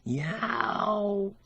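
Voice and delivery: high, soft cat yowl; a doubtful meow, half question; ambiguous cat yowl. A narrator's reading. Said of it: "put in an ambiguous".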